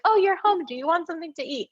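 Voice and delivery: high, bright, cheerful mom voice, then rushed; mock-sweet mother; imitating sweet mom. Mom casually welcoming her daughter home at dawn, high and cheery.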